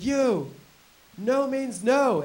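high, slow, emphatic scolding; stern lecture. Retold or imitated. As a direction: lecture him word by word. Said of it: "she said".

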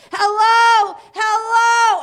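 high, full-voiced drive-thru yell; screaming mom. Mom screaming out of the car door at the drive-thru, hammed-up scream. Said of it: "she's, like, screaming".

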